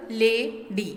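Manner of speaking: "lady" as two separate beats, la-dy; split into syllables. Shown as a demonstration. Teacher showing division before the consonant, "lady" syllable by syllable. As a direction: break "lady" with a pause before the d. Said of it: "divide before the consonant".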